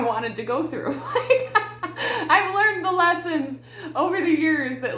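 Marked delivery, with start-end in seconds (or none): 1.1-5.0 laughing. Real, not acted. Laughs at life's lessons.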